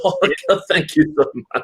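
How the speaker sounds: gushing thanks. Retold or imitated. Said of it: "I'm like".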